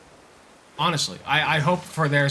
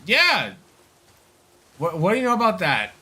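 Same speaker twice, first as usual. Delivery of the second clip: defensive Matt Walsh; 6 semitones higher, 4 dB louder than usual. Retold or imitated. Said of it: "And he's like".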